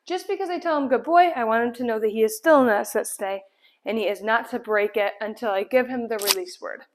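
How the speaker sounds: usual speaking voice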